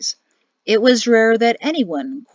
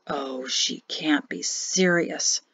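dismayed; 5 semitones lower, 5 dB quieter, slower than usual. Acted. Alone, dismayed at banner.

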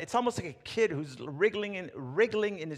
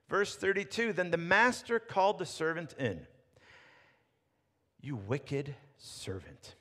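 scripture reading; 2 semitones higher, 3 dB louder than usual. Acted. Reads the parable's end aloud.

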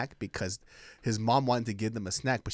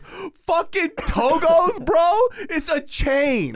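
high, loud, stretched outrage; blowing up; 10 dB louder, much slower than usual. Retold or imitated. Brother blowing up at friend over hyped chain sandwich shop. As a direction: imitate an incredulous yell, slow on each word. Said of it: "fucking blew up on him".

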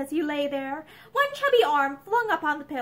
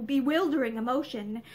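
ordinary delivery

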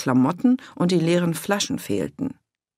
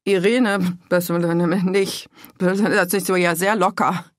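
mumbling; 3 semitones higher, 4 dB louder, slower than usual. A narrator's reading. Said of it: "Wieso nuschelte er so?".

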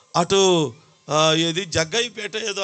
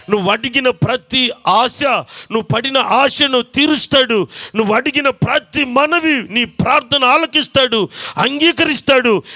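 preaching; 4 semitones higher than usual. Acted. Rising preaching.

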